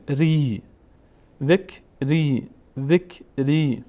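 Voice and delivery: ذک، ری slowly, "ree" held; short ذک, then stretch the ee of ری; long vowel. Shown as a demonstration. Teacher showing ra before ya, long vowel.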